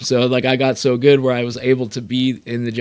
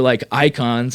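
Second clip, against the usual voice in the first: ordinary talk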